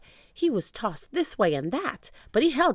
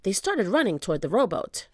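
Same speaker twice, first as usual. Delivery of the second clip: usual speaking voice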